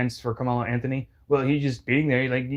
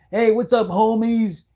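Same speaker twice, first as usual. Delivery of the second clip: mock greeting, higher and louder; wannabe gangster; 7 semitones higher, 4 dB louder than usual. Mocked man walks up to a group, wannabe-gangster greeting.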